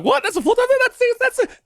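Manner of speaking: squeaky high disbelief; mover's voice jumping way up in amazement; amazed mover. Retold or imitated. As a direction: sputter like he can't believe it. Mahmoud's amazed reaction retold.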